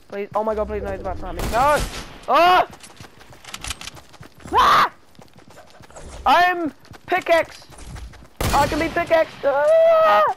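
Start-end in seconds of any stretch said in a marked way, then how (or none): 1.5-9.3 loud, high frantic shouts; panicked yelling. Real, not acted. Gamer caught with only a pickaxe, frantic high yelling. 9.4-10.2 very high, loud shriek; shrieking scream. Real, not acted. Gamer killed in the match, very high shriek.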